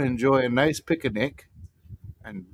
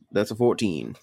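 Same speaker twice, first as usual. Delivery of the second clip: usual speaking voice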